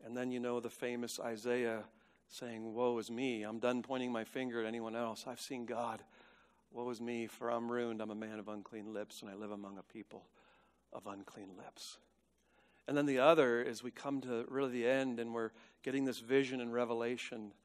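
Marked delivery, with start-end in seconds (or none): 2.7-12.0 soft, subdued prophet's lament; humbled confession. Acted. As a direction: lower the voice as if undone before God. Voicing Isaiah's confession of ruin.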